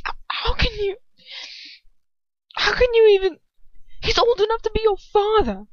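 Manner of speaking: high, loud, stammering outrage; outraged disbelief. A narrator's reading. Ron appalled at Hermione's older lover, outraged disbelief. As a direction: stumble in, then explode with outrage. Ron's angry outburst at Hermione.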